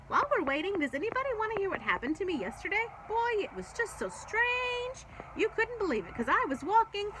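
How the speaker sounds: bursting to tell her story; eager chatter. A narrator's reading.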